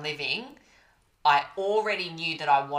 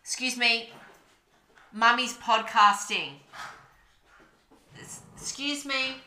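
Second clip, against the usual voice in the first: talking to the dogs; 7 semitones higher than usual. Real, not acted. Scolding dogs off the mic.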